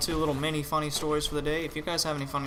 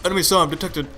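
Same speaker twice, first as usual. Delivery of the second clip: loud, clipped radio-announcer callout; African game announcer impression; 4 semitones higher, 9 dB louder than usual. Gamer fools his party by mimicking the match announcer, loud announcer voice. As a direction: bark the warning like the in-game announcer. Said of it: "I impersonated the, the guy just like that".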